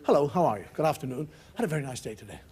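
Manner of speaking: low, neatly spoken small talk; perfect polite English. Retold or imitated. Father mimics how youngsters speak before eighteen, politely well-spoken. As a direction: recite pleasantries like a well-mannered youngster. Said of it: "They can speak perfect English".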